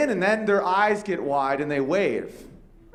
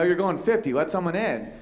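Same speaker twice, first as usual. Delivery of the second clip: usual speaking voice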